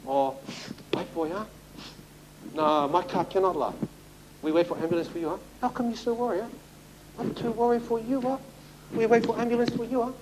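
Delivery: high, soft Chinese accent, mock concern; a fussing Chinese accent, pitched high; imitating the Chinese boy in a Chinese accent, refusing to drive him; Chinese-accented English. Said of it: "he said".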